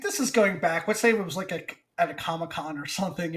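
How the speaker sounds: ordinary talk, nothing marked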